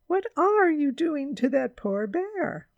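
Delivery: high, loud scolding question; high-pitched reproach; indignant cry. A narrator's reading. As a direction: cry out, appalled. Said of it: "cried Missus Brown".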